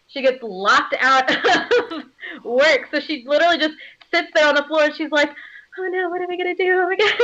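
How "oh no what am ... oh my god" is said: put-on fast, high girlish fretting; cute heroine in a hammed-up fluster; acted panic. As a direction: fret helplessly in a raised, softened voice. Voicing locked-out heroine in despair.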